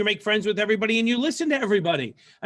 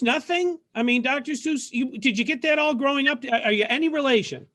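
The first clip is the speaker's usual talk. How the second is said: usual speaking voice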